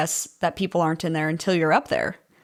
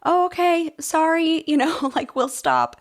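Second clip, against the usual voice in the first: higher, apologetic and sheepish; sheepish firefighters; 9 semitones higher, slower than usual. Retold or imitated. Firefighters apologizing to an upset neighbor, higher and sheepish. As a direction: back down politely, a little embarrassed. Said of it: "They were like".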